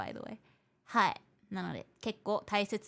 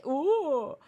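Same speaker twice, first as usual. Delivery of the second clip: imitating a loud, startled "oh"; mock the people caught off guard; loud, high surprised gasp of a word; 6 semitones higher, 8 dB louder than usual. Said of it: "that's the reaction".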